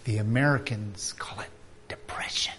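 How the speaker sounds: whispered, barely voiced; hushed aside. Real, not acted. Preacher naming depression in a hushed whisper. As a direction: drop to a whisper for the hard word.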